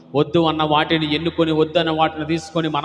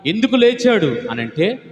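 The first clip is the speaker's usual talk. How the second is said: high, loud pulpit proclamation; fervent preaching; 7 semitones higher, 4 dB louder than usual. Real, not acted. Pastor proclaiming the resurrection, full-force preaching. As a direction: proclaim it to the whole church.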